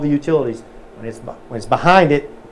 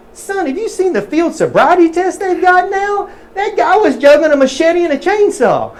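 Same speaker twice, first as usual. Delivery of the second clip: put-on much higher, slow, louder good-ol'-boy voice cracking into laughter; hammed-up punchline voice; 6 dB louder than usual. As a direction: sell the punchline in a big squeaky country voice.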